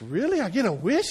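can't believe his luck; amazed guy. Acted. The beach guy answers the genie, high and amazed.

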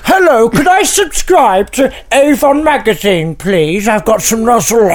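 high, slow, over-polite caller voice; hammed-up prim customer on the phone; put-on caller. Plays a magazine caller.

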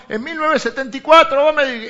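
loud, high accusing outburst; mock grudge. Pastor mimics a spouse dredging up old words, loud mock reproach. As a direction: fling an old grievance in hammed-up reproach.

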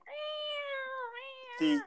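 very high, soft mock cat meowing. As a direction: mew like a cheap movie cat. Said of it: "you can hear the cats going".

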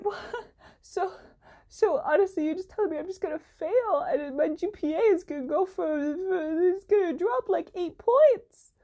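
high, strained, laughing disbelief; panicked half-laugh. Real, not acted. Telling viewers her grade will drop, high, laughing panic. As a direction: laugh through real panic about the grades. Panicking about failing the class.

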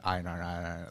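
"iron" run together over and over, blurred; Baltimore rapper's "iron". Retold or imitated. Retelling the rapper on a live, Baltimore pronunciation of iron. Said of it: "he just said".

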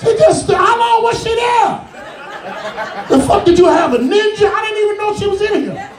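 high surprised outburst; startled shout. Acted. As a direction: caught off guard, yelling. Startled by hidden girl.

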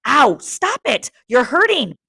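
put-on high, loud cry of a hurt boy, read aloud; pained cry. A narrator's reading. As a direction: cry out as the hurting gets real. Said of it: "then in real pain".

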